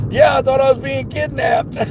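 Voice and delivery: high imitation of a rattled customer; retold alarm. Customer answers driver asking if she's okay, mock alarm.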